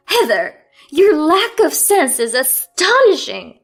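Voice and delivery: high, loud, scornful scolding. A narrator's reading. Mother-in-law scolding daughter-in-law over the mess, high scornful rant. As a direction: sneer down at her.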